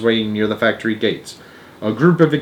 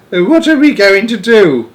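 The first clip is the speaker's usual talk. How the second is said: wailing; 9 semitones higher, 5 dB louder than usual. A narrator's reading. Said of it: "wailed Grandma Georgina".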